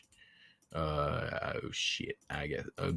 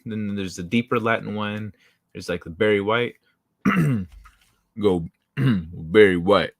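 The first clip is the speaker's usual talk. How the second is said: ordinary delivery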